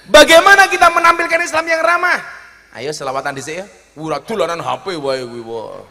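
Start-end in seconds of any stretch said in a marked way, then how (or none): 0.1-2.2 high, loud rallying question; calling out. Real, not acted. Preacher returning to his theme before the congregation, loud oratory. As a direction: project it over the whole crowd.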